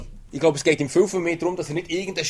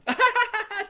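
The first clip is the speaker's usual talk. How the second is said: mocking laugh. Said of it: "haben ausgelacht".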